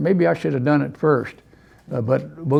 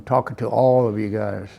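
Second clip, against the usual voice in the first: ordinary talk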